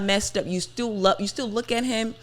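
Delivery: usual speaking voice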